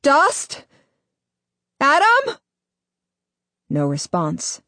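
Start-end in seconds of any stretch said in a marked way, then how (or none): 0.0-0.7 high, loud call into the depths; shouting. A narrator's reading. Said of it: "shout into the gulf". 1.8-2.4 high, loud questioning yell; shouted call. A narrator's reading. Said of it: "shout into the gulf".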